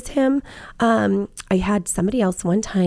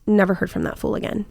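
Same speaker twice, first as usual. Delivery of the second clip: ordinary talk, nothing marked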